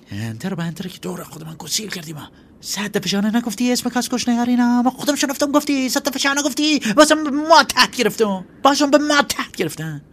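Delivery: furious rant. Acted. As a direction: boil over and yell at your partner. Ayoub raging at Baksur Ali.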